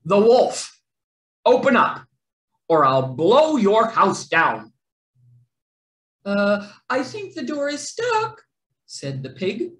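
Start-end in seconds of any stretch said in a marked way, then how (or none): 0.0-4.7 loud, gruff wolf demand; loud storybook wolf threat. A narrator's reading. 6.2-8.3 pig voice. A narrator's reading. Pig makes excuse to wolf.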